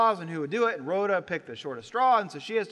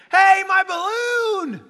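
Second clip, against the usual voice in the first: put-on high, loud, slow child's wail; child crying out; 12 semitones higher, 10 dB louder, much slower than usual. Small child losing a balloon, loud high wail. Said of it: "the little child responds and goes".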